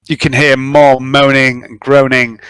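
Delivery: normal talking voice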